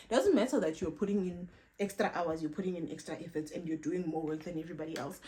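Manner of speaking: ordinary talk, nothing marked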